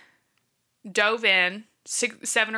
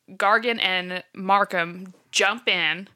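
ordinary talk

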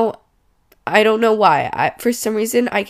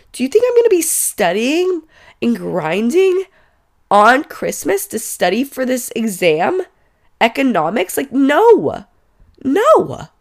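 incredulous; 5 semitones higher, 4 dB louder than usual. Real, not acted. Venting at the professor, exam timing.

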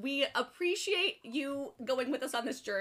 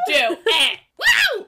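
loud cheering shout; excited shout; 7 semitones higher, 11 dB louder than usual. Real, not acted. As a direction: cheer the start loudly. Hyping up the episode.

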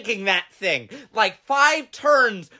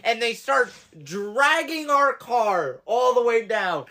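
normal talking voice

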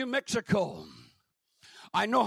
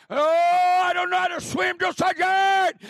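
recruit shouting back; 8 semitones higher, 8 dB louder than usual. Retold or imitated. Recruit shouting his answer to the drill sergeant. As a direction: sound off to the sergeant at full volume.

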